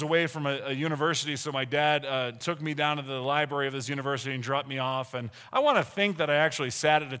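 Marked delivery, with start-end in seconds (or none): none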